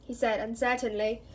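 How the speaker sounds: ordinary delivery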